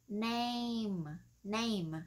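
"name" drawn out with an "ay" glide; A sound in name. Shown as a demonstration. Teacher correcting a common mistake, slow "name" with A sound. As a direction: stretch the "ay" in "name". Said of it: "Nó là chữ A á".

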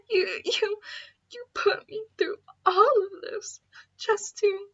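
tearful choked whisper; crying. Acted. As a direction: break down mid-sentence. Tearful breakdown.

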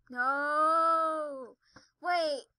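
loud, long wail of protest; dismayed cry. Real, not acted. Player hit by a sudden mishap, dismayed cry. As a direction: cry out as it goes wrong.

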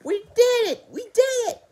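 high, loud excited squeal; triumphant cheer. A narrator's reading. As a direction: cheer it out in celebration. Koala celebrates in the story.

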